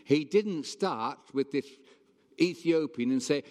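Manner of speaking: normal talking voice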